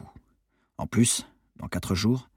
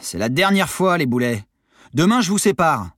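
high, loud exasperated threat; fed-up warning; 8 semitones higher, 6 dB louder than usual. A narrator's reading. Monitor warning two useless sailors, fed up. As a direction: lay down the law loudly.

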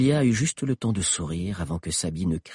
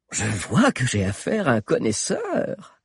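appreciative witch; 6 semitones higher than usual. Acted. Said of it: "apprécia Mordelia".

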